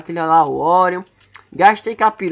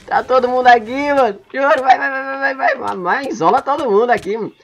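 excited urging; 6 semitones higher than usual. Real, not acted. Urging in the chaos.

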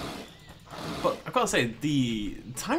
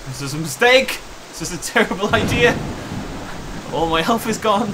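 panicked; 6 semitones higher, 5 dB louder than usual. Real, not acted. Plan failing, fleeing dinosaur.

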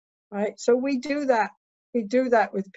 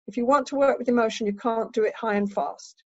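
normal talking voice

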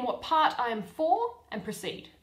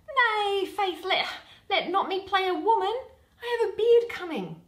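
protesting youth; 8 semitones higher, 3 dB louder than usual. Acted. Flute protests playing a woman.

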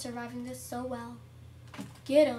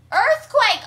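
high, loud warning yell; excited high-pitched shout; alarm shout; 9 semitones higher, 17 dB louder than usual. Real, not acted. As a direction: call out the disaster. Game disaster is announced.

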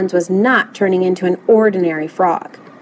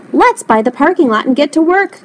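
higher-pitched eager call; excited cry; 6 semitones higher than usual. A narrator's reading. Librarian rallies Lewis to start work, crying out. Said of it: "cried Miss Sievers".

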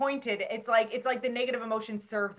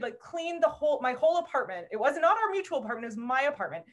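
ordinary talk, nothing marked

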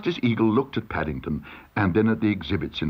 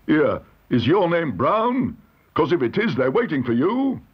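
court usher; 6 semitones higher, 4 dB louder than usual. A narrator's reading. Usher accosts Paddington in court.

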